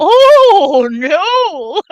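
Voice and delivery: imitating the neighbor's alarmed oh no; imitating her. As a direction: squeal it like the neighbor. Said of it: "I can hear her voice in my head".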